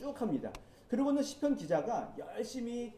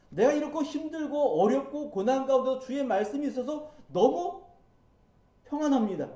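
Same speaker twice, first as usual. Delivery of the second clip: rising, louder joyful praise ending; triumphant praise; 5 semitones higher, 4 dB louder than usual. Pretend. Preacher voicing the ideal psalm ending, louder and higher. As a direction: lift it up like a glorious final hymn.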